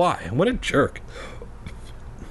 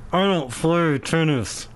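muffled words through clenched teeth; 3 semitones higher than usual. Shown as a demonstration. Demonstrating how the tennis reply sounded, clenched teeth. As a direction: grit your teeth and squeeze the words out. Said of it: "probably how it sounded with clenched teeth".

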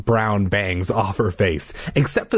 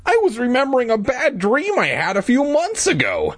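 put-on high boy voice. Boy character voiced in dialogue.

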